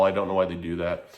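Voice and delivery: ordinary talk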